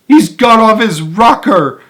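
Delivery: high, loud, slow alarmed shout; aghast shout. A narrator's reading. Father panicking at Wonka in the tunnel, aghast shout. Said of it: "shouted one of the fathers aghast".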